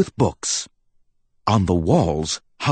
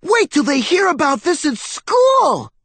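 very high excited squeak; squeaky little nephew; 4 dB louder than usual. Acted. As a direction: squeak it like a thrilled kid mouse. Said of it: "Benjamin squeaked".

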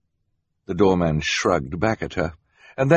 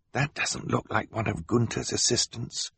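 softer, half-whispered aside; 4 semitones higher, 6 dB quieter than usual. A narrator's reading. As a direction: lean in and whisper to your sisters. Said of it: "Klaus whispered to his sisters".

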